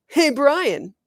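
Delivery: loud call across the lawn. A narrator's reading. Bully calling his friend over to look. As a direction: shout to a friend across the schoolyard.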